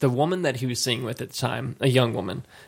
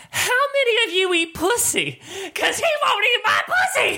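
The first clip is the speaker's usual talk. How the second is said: put-on much higher laughing drunk girl wail; drunk upset girlfriend. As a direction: whine it like a hurt tipsy girlfriend. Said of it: "She's like".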